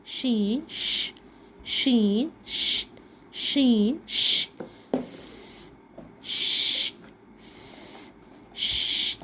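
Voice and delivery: slow "شین ش" then hissed "س" and "ش"; hissing letter sounds. Shown as a demonstration. Teacher hissing letter sounds for children. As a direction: contrast a sharp "س" hiss with a hushing "ش". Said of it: "بن جاتا ہے شین ش".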